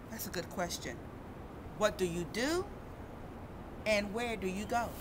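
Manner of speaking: sing-song storybook read with character quotes; reading to children. Acted. Reading a snow picture book to kids, sing-song. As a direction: read it out gently to a young audience.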